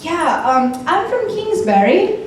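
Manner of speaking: hesitant fake British, jumping high; put-on British accent. Faker asked where in England she's from, high British accent. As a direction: answer in a pretend British accent, pitch rising high. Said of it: "I've ever used a British accent".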